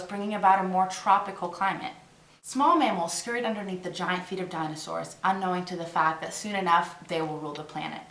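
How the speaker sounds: ordinary talk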